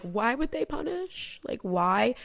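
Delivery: incredulous. Retold or imitated. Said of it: "you're kinda like".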